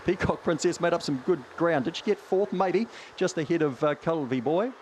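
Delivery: fast live race commentary; race caller. Acted. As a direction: rattle off the field like a track caller. Calls the dog race live.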